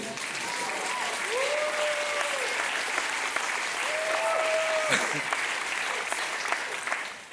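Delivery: long high-pitched laugh. Real, not acted. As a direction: let out a long giggle. After mother's defiant quote.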